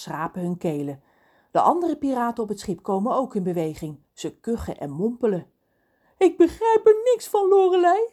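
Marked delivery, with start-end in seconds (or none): 6.2-8.1 very high, loud protest; baffled exclamation. A narrator's reading. Reus questions Lorelei about the rescue, baffled and loud. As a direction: protest loudly in utter confusion.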